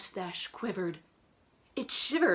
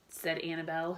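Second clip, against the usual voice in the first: ordinary delivery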